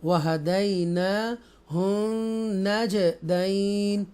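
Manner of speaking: नजदैन with long nasal ghunna on the doubled nun; recited with ghunna. Shown as a demonstration. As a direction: hold the nasal hum through नजदैन. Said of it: "ऐसे पढ़ेंगे".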